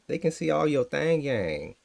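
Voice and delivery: normal talking voice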